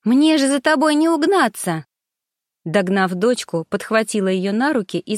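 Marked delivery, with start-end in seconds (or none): 0.1-1.9 high, bright playful mom call after her daughter; laughing after a running child. A narrator's reading. Mother chasing daughter playfully.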